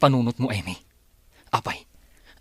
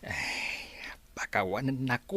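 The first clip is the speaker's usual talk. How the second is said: whisper; 8 dB quieter, much slower than usual. Acted.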